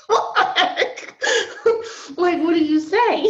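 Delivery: laughing helplessly through tears; laugh-crying. Real, not acted. Telling the host God's rebuke left her speechless, laugh-crying.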